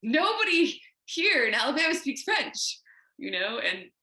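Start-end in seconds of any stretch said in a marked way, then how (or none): 0.0-2.8 put-on high, louder indignant kid voice; kid protesting. Girl in Alabama protests to her parents in a high mock voice. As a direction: push back hard as the exasperated child. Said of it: "I'm like".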